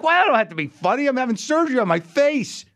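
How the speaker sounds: high-pitched comic protest; acted-out exasperation; indignant realization. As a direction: argue back in mock outrage, pitch way up. Retold protest during surgery.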